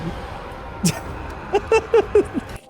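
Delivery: wheezing silent laugh, very high; breathless high laugh. Real, not acted. Laughing hard at chat.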